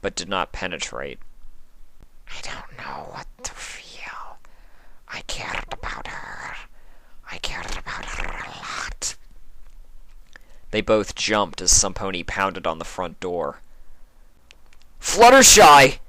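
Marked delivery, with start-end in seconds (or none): 2.3-9.2 remorseful changeling. Acted. Sympathy whisper voice. 15.0-16.0 very loud, high shout through door; calling out. Acted. Shining Armor outside the door, very loud urgent shout. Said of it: "called Shining Armor's voice".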